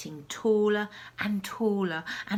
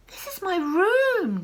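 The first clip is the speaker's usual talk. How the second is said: indignant cry; 9 semitones higher, 7 dB louder than usual. Acted. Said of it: "George said".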